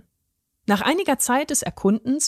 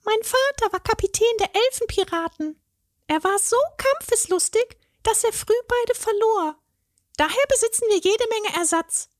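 very high fairy voice, a bit sighing; wistful fairy; 13 semitones higher than usual. A narrator's reading. Fairy explains her pirate father to the troll, high and wistful. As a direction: tell of a fierce father with a wistful shrug. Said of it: "Stefanie seufzte".

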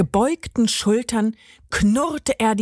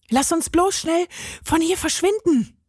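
higher, urgent call; 9 semitones higher than usual. A narrator's reading. Carlos urges Benny to flee, calling out. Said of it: "rief Carlos".

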